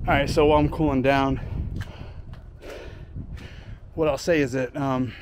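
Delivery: winded after a workout; catching breath between phrases. Real, not acted. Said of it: "let me catch my breath here".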